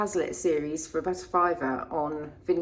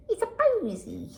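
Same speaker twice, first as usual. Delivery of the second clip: much higher, laughing "It's a posy!" with "posy" said as a squeal; delighted exclaim; 11 semitones higher than usual. Real, not acted. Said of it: "Posy! laugh".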